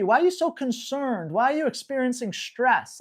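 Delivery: ordinary talk, nothing marked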